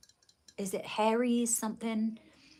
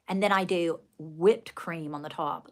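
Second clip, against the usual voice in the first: ordinary delivery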